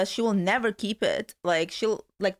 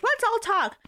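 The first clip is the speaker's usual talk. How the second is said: high mock-earnest "let's all talk"; sing-song mimicry of the housewife; mocking her; 4 semitones higher than usual. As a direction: parody her stirring up a group confrontation. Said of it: "because she's like".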